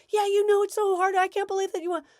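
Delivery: put-on upset kid. Kid complaining to parent, high mock whine. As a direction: play the whiny ranting kid. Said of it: "kid ranting back".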